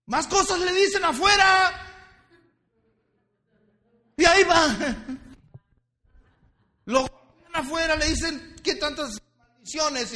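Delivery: high, loud shouted sermon; fiery preaching. Acted. Pastor shouting at the congregation, fiery preaching. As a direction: preach at full force.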